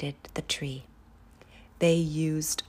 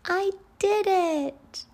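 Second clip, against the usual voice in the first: much higher, slow tiny snowflake voice; tiny tinkling snowflake whisper; 6 dB louder, slower than usual. Acted. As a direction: squeak it out proudly like a snowflake. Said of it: "said the snowflake".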